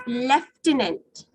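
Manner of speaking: LIEUTENANT slowly as lef-tenant. Shown as a demonstration. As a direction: model "lieutenant" with an f sound. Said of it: "when F sound is not there".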